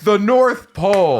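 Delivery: triumphant and drawn out; excited. Real, not acted. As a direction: announce it like a winner. Triumphant punchline answer.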